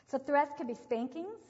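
usual speaking voice

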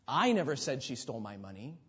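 "I" stressed at the start; emphasize "I" above all other words. Shown as a demonstration. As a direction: hit the word "I", then let the rest follow. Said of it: "emphasize a different word".

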